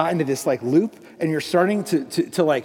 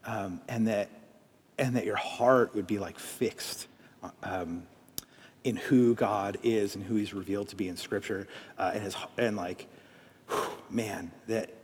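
quiet, lower, slow heartfelt prayer; soft prayer; 5 semitones lower, 7 dB quieter than usual. Acted. A pastor prays softly over hurting listeners in a lowered, slow voice. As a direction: pray over them gently, pausing often.

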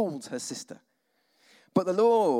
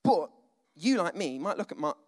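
usual speaking voice

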